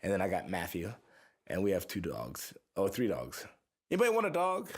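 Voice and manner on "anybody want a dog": loud, high call to the room. Real, not acted. Musician calling out to listeners to take his dog, loud and high. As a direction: shout the offer out to everyone.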